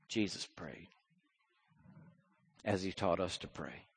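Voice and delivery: normal talking voice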